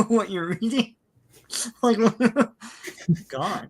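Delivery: laughing through the words; cracking up while talking. Real, not acted. Laughing at the comic.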